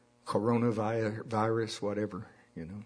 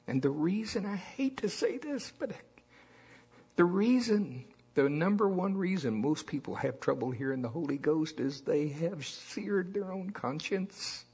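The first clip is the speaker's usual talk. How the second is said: preaching; 6 semitones higher than usual. Acted. Preaching emphasis.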